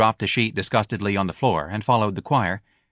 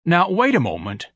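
higher, urgent plea; anxious, raised appeal; begging; 9 semitones higher, 4 dB louder than usual. A narrator's reading. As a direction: plead with them to stay. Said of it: "Ignatius begged".

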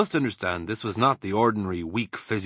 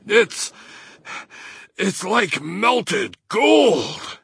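gasped; 11 semitones higher, 5 dB louder, much slower than usual. Acted. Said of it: "he gasped".

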